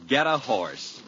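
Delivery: ordinary talk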